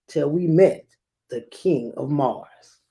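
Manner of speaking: put-on lively read-aloud for children; storybook reading voice; story narration. A narrator's reading. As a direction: read it like a picture book to a class.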